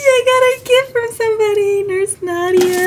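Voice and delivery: high, loud, near tears with joy; overjoyed. Real, not acted. Nurse showing viewers her gift, overjoyed cry. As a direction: cry out the news, overwhelmed.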